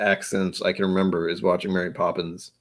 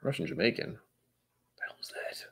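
usual speaking voice

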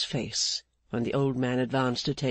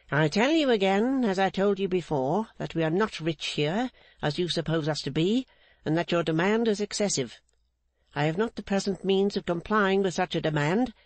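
Mrs Clennam; 5 semitones higher than usual. Acted. Mrs Clennam refusing Rigaud's demand, higher stern unbending woman. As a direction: hold the line like an unbending matriarch.

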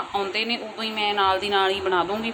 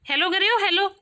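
excited calling; 9 semitones higher, 4 dB louder than usual. Real, not acted. Mother telling child papa has come, excited calling.

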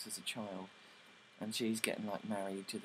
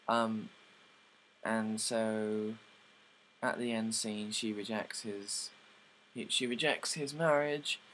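ordinary talk

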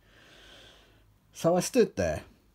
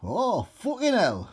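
higher-pitched dismayed exclamation; straining character; 8 semitones higher than usual. A narrator's reading. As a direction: curse in a raised comic voice. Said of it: "when he began to strain".

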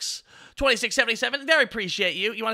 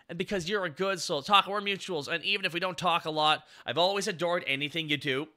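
softer, lower, heartfelt; sincere thanks; 6 semitones lower, 6 dB quieter than usual. Real, not acted. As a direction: speak it gently from the heart.

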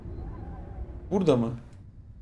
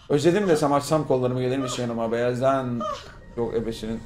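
ordinary delivery

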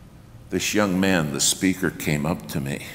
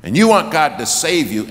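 louder, higher, faster altar call; preacher pleading with the crowd at full volume; rising preacher's shout; 4 semitones higher, 8 dB louder than usual. Retold or imitated. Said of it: "he keeps raising his voice".

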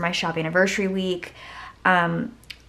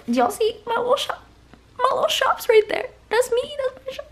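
squeal. Real, not acted. Spotting her own shop sticker.

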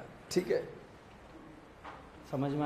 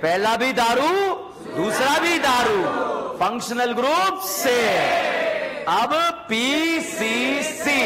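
loud, drawn-out call-and-response teaching; classroom drill call; 5 semitones higher, 5 dB louder, much slower than usual. Real, not acted. Teacher drilling students on isomers, in a loud drawn-out chant. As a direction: call out each step, stretching the key word.

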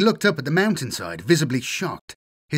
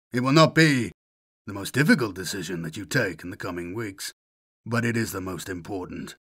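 icy calm menace; 5 semitones lower, 3 dB quieter than usual. Acted. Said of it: "His voice was icy calm".

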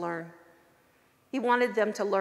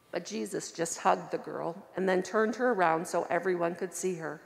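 plain reading voice

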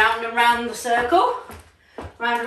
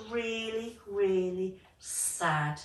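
slow sad emphasis; 5 semitones lower, 12 dB quieter, much slower than usual. A narrator's reading. Storyteller stressing sadness.

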